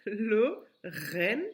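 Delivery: "Lo, ren" as two separate rising beats; syllable by syllable. Shown as a demonstration. Teacher restarts the correction, "Lo, ren" said as isolated syllables. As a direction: pause between "Lo" and "ren". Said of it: "La première syllabe".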